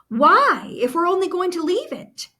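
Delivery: put-on a child who can't see the point; puzzled child. Child questions mother.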